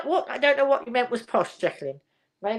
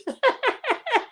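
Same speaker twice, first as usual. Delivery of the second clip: high amused giggle. Real, not acted. Amused about Lisa's returns.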